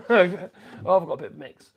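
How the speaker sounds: "I've got a bit of a mix" through a chuckle; amused laughing repeat. Real, not acted. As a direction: laugh at your own tool mix.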